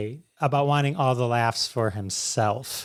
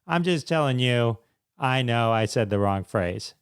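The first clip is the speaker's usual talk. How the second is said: usual speaking voice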